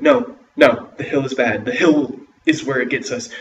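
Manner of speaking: loud, urgent no; loud alarmed warning. A narrator's reading. Children refusing the hill in alarm, loud. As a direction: shout the danger. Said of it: "and they're like".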